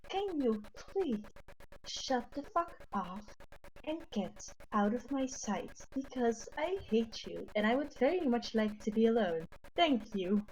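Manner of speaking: soft, measured Elvish-character voice; very calm, polite. Acted. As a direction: deliver venom as gentle courtesy. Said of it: "very calmly, in my best accent, say".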